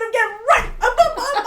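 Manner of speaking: high, loud squeal breaking into laughter; shrieked, stretched out and giggly; comic frantic retelling. Retold or imitated. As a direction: act out the panic at full volume. Acting out her jump, laughing.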